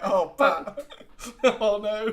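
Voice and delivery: helplessly amused, words breaking into laughs; laughing. Real, not acted. Laughing at the manager's blunt retort.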